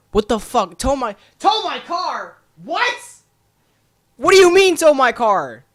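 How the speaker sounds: high-pitched, loud squawk of disbelief; incredulous outburst. Retold or imitated. Outburst at hearing of towing.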